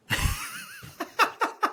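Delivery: very high-pitched imitated cackle; mimicked scary laugh. Dad copying son's impression of him, mimicked scary laugh. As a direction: imitate a weird, squealing laugh.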